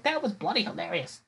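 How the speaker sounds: put-on high, soft British accent; imitated British manager. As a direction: mimic a British lady, lightly and high. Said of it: "she's just cracking up".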